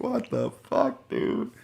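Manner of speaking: slow, rising to a high squeaky dude; incredulous retort. Retold or imitated. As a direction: disbelief at the uncle. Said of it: "And I was like".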